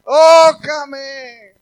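loud, high, desperate cry to God; a man shouting to God across the field, crying out loud; retold shout. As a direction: yell it out, pleading to be filled. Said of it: "se oía desde lejos que gritaba".

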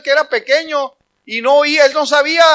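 ordinary delivery

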